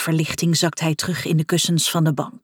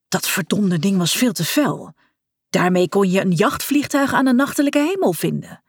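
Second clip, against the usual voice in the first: plain reading voice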